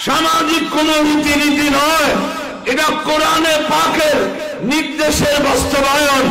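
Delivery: high, ringing sermon cry; preaching at full force. Acted. Preacher telling the gathering the mahfil's purpose, in full preaching voice. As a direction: lift the crowd with the Quran's command.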